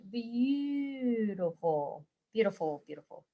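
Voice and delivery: slow, dying away to almost nothing; fading admiration. Real, not acted. Admiring the finished bird.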